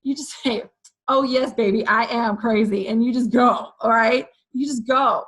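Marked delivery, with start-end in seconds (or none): none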